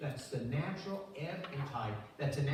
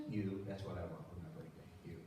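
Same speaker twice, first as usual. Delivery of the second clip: tender whisper-like answer; 9 semitones lower, 9 dB quieter than usual. Retold or imitated. Husband's tender answer to wife.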